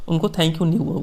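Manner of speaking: ordinary delivery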